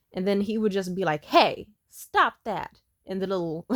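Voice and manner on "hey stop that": slow, louder mock parental telling-off. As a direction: scold slowly like an ineffectual parent.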